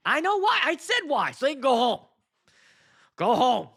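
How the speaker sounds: loud, high-pitched complaint; exasperated rant. Real, not acted. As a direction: let the frustration burst out loudly. Rants at vendors rushing the night.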